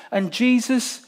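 ordinary talk, nothing marked

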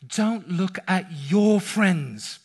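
slow, weighted parental warning; slow firm advice. Retold or imitated. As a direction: lean on every word, slowly. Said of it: "we would say to them".

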